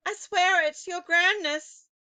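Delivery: high frightened plea; cried. A narrator's reading. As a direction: cry it out in terror. Witch begs.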